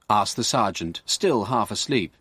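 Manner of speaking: ordinary delivery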